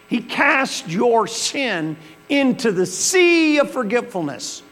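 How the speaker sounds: high, loud fervent preaching; high, loud praise of mercy. Acted. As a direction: preach it with full fire. Preaching at full force.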